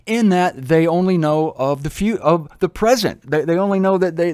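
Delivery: ordinary talk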